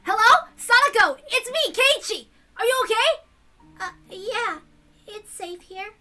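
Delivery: put-on high, loud, excited boy calling out; excited friend reassuring her over the phone; eager teenage boy. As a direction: burst in eager to rescue her. Keiichi voiced on phone.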